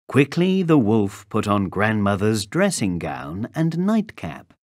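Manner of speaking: ordinary delivery, nothing marked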